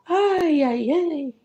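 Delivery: high, wailing ay ay ay; mock dismay of the wagon owner; lightly hammed-up lament; upset cart man. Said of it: "He's like".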